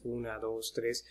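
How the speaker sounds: ordinary talk, nothing marked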